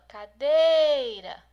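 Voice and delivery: loud "cadeira", held long on DEI; calling out. Shown as a demonstration. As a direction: call "cadeira" as if it were someone far away. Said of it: "Você tá chamando a cadeira". (chamando alto "cadeira", esticando o DEI)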